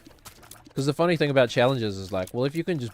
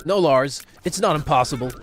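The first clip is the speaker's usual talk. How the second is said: louder, insistent rock-star voice; 4 semitones higher, 6 dB louder than usual. Retold or imitated.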